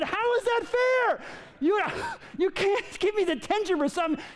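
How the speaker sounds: high, squeaky outraged protest; outraged kid. Retold or imitated. Student protesting detention to the teacher, high and squeaky.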